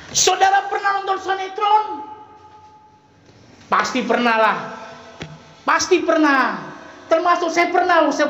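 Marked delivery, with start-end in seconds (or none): 0.1-1.8 rousing shout. Real, not acted. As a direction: fling the question out to the crowd. Preacher calls out to congregation.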